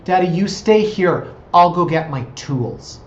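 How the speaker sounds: earnest little-kid helper, lightly voiced and drawn out; little daughter. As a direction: imitate a three-year-old taking charge to help Daddy. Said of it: "And she said".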